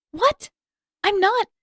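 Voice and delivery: put-on high, loud indignant girl; indignant surprise. A narrator's reading. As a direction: jump up, offended. Katara indignant at teasing.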